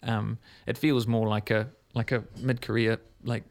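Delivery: ordinary delivery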